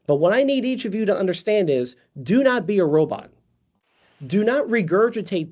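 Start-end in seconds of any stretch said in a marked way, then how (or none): none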